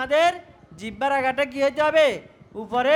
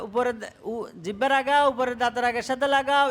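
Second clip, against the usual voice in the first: ordinary talk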